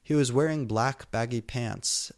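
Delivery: usual speaking voice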